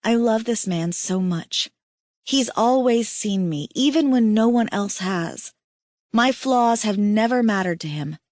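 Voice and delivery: ordinary delivery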